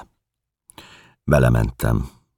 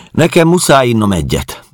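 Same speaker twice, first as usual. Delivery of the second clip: high, loud caretaker's voice; caretaker woman; 12 semitones higher, 6 dB louder than usual. Acted. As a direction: announce it as the caretaker, pitched up. Caretaker figure speaks in story. (magas, hangos házmesternő)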